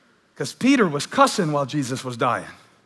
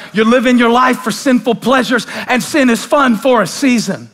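loud, higher, hammering preacher's cry; full-force preaching; 10 semitones higher, 9 dB louder than usual. Acted. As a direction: preach it hard at the crowd.

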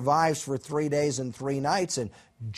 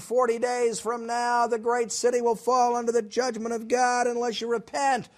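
proclaiming; 8 semitones higher than usual. Retold or imitated. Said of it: "and he proclaimed".

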